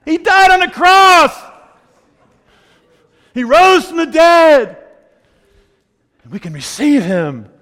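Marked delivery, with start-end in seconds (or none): 0.1-4.8 high, slow, full-voiced shout; real pulpit cry, each word stretched; triumphant preaching shout. Real, not acted. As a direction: proclaim it at the top of your voice. Preacher proclaims the core of message.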